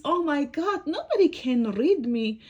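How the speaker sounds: high, loud dismay; hammed-up exasperated lament; dismayed storybook book. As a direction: cry out as the distraught little book. Book laments after dog fails.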